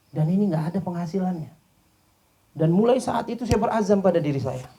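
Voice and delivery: high and loud, a heartfelt confession; rising emotion. Acted. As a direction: let it swell as he tells the vow. Man recounting his vow, rising emotion. (pengakuan yang makin emosional)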